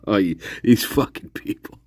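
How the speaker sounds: ordinary delivery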